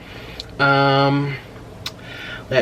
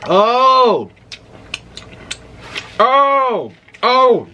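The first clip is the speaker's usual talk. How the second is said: high, loud, drawn-out "oh"; burning-mouth exclamation; 8 semitones higher, 3 dB louder, much slower than usual. Real, not acted. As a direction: cry out at the heat of the spice. Reacting to very hot wings.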